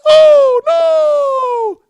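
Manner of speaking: very high, loud squeaky cry of alarm; Mister Bill scream. Retold or imitated. Podcaster ends the Mister Bill bit with a squeaky scream. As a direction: shriek it in the tiny cartoon voice. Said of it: "in the style of Mister Bill".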